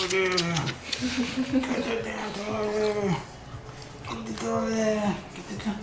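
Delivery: very slow, long stretched coaxing; drawn-out coaxing. Real, not acted. Trainer coaxing the dog to fetch the toy, very slow. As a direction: drag each word out to coax the dog. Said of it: "trainer urging dog for toy".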